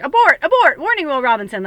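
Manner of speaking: high, loud mock robot warning; robot alarm. Reader voicing spirit's alarm to overthinking viewers, high mock robot warning. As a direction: blare it like a panicking sci-fi robot.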